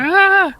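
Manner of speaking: sudden high startled scream. Acted. Vice principal startled by the ghost, short high scream. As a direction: shriek in fright. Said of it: "She screams first".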